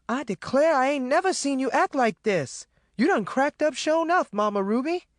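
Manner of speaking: high-pitched, alarmed Southern yell. A narrator's reading. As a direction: yell in shocked disbelief. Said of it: "Maureen yelled".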